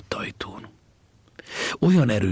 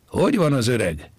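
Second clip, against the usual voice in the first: loud, raised-pitch call across a distance; 6 semitones higher, 5 dB louder than usual. Acted. Fisherman calls to the boy by the skiff, shouting. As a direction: shout the question over to someone. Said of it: "kiáltott felé az egyik halász".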